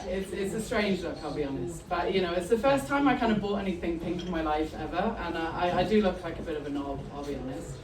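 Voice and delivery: ordinary talk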